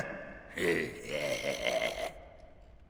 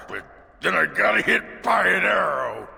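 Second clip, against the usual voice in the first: excited; 5 semitones higher, 4 dB louder than usual. Real, not acted. Excited retelling of a fight.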